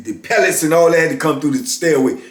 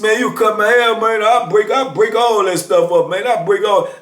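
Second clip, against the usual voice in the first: higher-pitched mock outburst; heated young challenger, voice raised in pitch; retold angry threat; 6 semitones higher than usual. Acted quarrel, youth threatens to break clippers.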